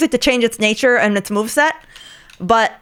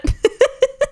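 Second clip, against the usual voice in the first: squeaky little giggle; high-pitched giggle; 13 semitones higher than usual. Real, not acted. Giggle after talking high voice.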